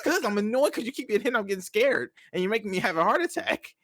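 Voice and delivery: high-pitched retold fretting; upset, annoyed mom. Mom scared by son getting hit, annoyed. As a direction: imitate a mother scolding in exasperation. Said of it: "she kept getting upset".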